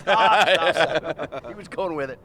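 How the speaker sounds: laughing. Real, not acted. Host laughing at the joke.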